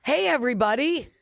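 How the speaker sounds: high, cheery host hello; bright radio greeting. Retold or imitated. Staffer's wrong greeting to a group of listeners, high and cheery. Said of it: "who would say".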